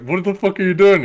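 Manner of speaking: high, loud incredulous outburst; mock freak-out. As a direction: imitate a man freaking out, voice shooting up. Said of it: "Stewart's freaking out, and he's like".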